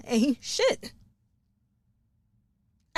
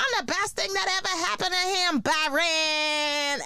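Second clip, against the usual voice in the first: put-on bragging baby mom, pitched higher; mocking a smug baby mom; 7 semitones higher than usual. Mimicking the smug baby mom bragging about Byron, higher. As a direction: ham up her boasting. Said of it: "she walk around talking about".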